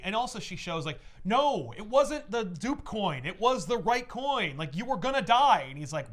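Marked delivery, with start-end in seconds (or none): 1.2-5.7 raised, higher arguing voice; insistent retort. Retold or imitated. Voicing Selina arguing back about the coin, heated insistence. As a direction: push back emphatically as the quarreling character.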